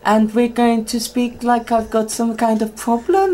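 high, badly faked Welsh; hammed-up Welsh voice, climbing high; Welsh accent. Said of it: "a very thick Welsh accent".

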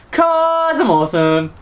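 high, slow smug boast; smug cartoon voice. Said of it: "And he goes".

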